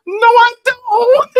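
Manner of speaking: high, loud, slow screamed refusal; a barber screaming his refusal at a flirty client; retold scream. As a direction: scream it out, drawn out and horrified. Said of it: "He just screamed like".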